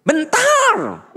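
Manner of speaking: shouting parent; yelling. Shown as a demonstration. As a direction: shout up the stairs at the child. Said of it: "Coba lamun teriak".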